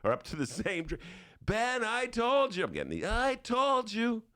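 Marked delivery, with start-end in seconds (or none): 1.4-2.6 high, slow whine; whiny I told you. Retold or imitated. Bernie Bro complaining. 3.1-4.2 high, slow whine; whiny I told you. Retold or imitated. Bernie Bro complaining.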